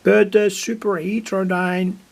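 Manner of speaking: ordinary talk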